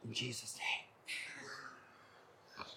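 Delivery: very slow, hushed whisper; barely audible whispered prayer. Shown as a demonstration. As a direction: whisper it slowly, almost soundless. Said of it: "I could just even whisper".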